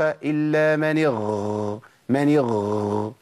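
اغ dragged out very slowly into a gargle; gargled ghayn. Shown as a demonstration. Teacher imitates the gargling mistake in اغترف. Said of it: "ما ينفعش".

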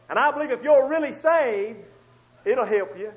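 ordinary delivery

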